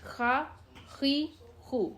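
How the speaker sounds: "خا", "خی", "خو" slow and separate, with throaty kh friction; unspelled syllable drill. Shown as a demonstration. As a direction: drill "خا، خی، خو" one drawn-out syllable at a time. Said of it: "جوڑ کر کے پڑھنے کی ضرورت نہیں ہے".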